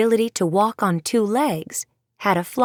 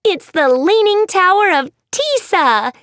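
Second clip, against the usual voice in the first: much higher, louder giddy voice; a showoff bursting to share; zany Maddie; 11 semitones higher, 6 dB louder than usual. Acted. Maddie to her friends, giddy and showy.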